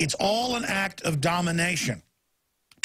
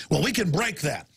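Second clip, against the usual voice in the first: ordinary talk, nothing marked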